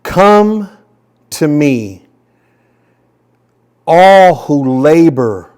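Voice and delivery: slow, weighty scripture reading with long pauses; unhurried solemn reading. Shown as a demonstration. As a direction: read it out slowly, letting each phrase land. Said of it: "I'm gonna read this to you just a little bit slower".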